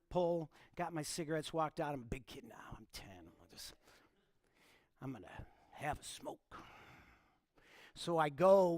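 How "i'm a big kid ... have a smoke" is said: put-on whispered like a kid plotting mischief; sneaky whisper. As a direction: hush it like you're hiding from mom. Boy sneaking first cigarette, whispering.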